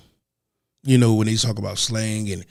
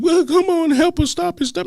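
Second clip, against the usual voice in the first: whiny protester. Retold or imitated. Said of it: "stop all that crying ass shit".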